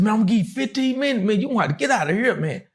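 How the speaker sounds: high, fast, anxious fifteen minutes; high, rushed jittery warning; nervous deadline. Retold or imitated. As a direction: set a hurried limit. Homeowner sets a deadline.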